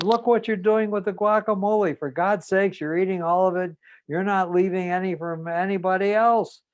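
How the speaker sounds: loud, high, outraged scolding; mother scolding. Retold or imitated. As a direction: scold him hard in front of the family.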